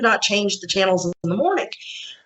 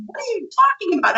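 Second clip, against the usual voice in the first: high, baffled question; mock the husband's confusion; puzzled husband; 12 semitones higher than usual. Husband answers his panicking wife, baffled. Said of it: "my husband's like".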